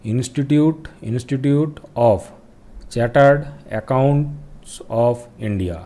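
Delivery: spelled out very slowly, word by word; slow full form. Shown as a demonstration. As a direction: dictate each word slowly for students to write. (बहुत धीरे, शब्द-शब्द बोलते हुए)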